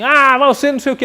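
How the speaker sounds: high, loud and fast, as if yelling at someone; mock yell. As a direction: act out an angry outburst, shrill and quick. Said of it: "Uma pessoa grita com você". (grito encenado, alto e rápido)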